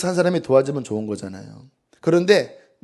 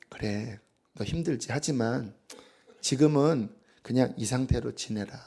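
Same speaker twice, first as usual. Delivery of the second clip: much softer, tender and calm; gentle voice of the Lord; 4 semitones lower, 9 dB quieter than usual. Acted. The Lord comforting the weary heart, softly. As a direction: speak softly like comforting a tired child. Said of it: "주님이 제 안에서".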